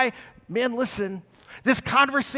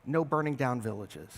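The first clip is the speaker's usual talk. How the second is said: calm Jesus; 9 semitones lower, 9 dB quieter than usual. Retold or imitated. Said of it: "And Jesus says".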